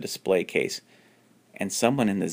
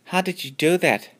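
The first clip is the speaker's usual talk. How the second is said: high, amazed question; 11 semitones higher than usual. Acted. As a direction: ask in breathless wonder. Megan amazed after rescue.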